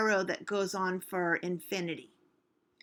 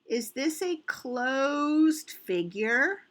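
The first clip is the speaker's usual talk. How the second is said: drawn-out, higher question with rising end; slow pondering question; 4 semitones higher, 3 dB louder, slower than usual. Real, not acted. Teacher asking children about a closed figure, slow and questioning. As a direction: stretch the question out to make them think.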